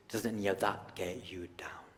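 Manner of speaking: breathy whispered question. Acted. Asking the audience if it gets him down, whispered. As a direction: whisper it like a secret.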